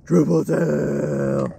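very slow, relishing the name; drawn-out savouring. Real, not acted. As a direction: stretch it out like a prize. Father savours the fish name.

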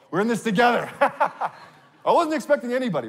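loud, high words bursting with laughter; laughing. Real, not acted. Preacher joking with one audience member, laughing loudly. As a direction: crack up at your own surprise.

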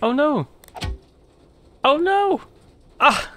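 high rising panic; alarmed cry. Acted. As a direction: cry out as she falls. Girl of glass knocked over.

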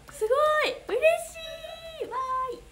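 high, squealing joy; excited delight. Real, not acted. Delighted reaction to a fan's comment. (甲高い声で大喜び)

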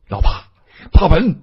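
high, loud call to a wounded friend; desperate shout; anguished cry. Acted. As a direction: cry the friend's name in panic. Cries out to wounded comrade.

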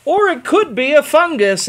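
doctor voice. Acted. Doctor's suggestion, high loud.

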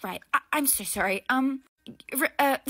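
normal talking voice

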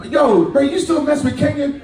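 mock friend asking; nosy friend. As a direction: poke at him like a curious buddy. Friend asking about Kenya.

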